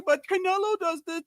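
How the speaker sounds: high, loud whiny mock of a caller. Imitating callers who excuse Tank, high and loud mock whine. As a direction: whine it like a know-it-all caller. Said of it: "they say".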